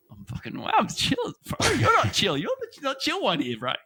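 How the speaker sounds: hammed-up defensive rant; mock outburst. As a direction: blow up at the server, half-joking. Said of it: "tell him I was".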